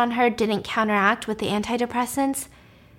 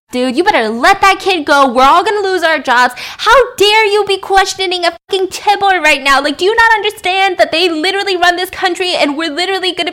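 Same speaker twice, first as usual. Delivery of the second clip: much higher, much louder furious rant; angry boss yelling; 9 semitones higher, 10 dB louder than usual. Retold or imitated. Boss tearing into the captain on the phone, screaming furiously. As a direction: berate him like a livid boss. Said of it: "the boss is not being nice".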